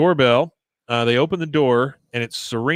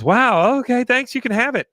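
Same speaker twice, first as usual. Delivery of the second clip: much higher, louder, breathless eager reply; starstruck gush; 11 semitones higher, 5 dB louder than usual. Retold or imitated. Shy girl thrilled a popular girl noticed her, eager high gush. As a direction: hand it over like meeting a celebrity.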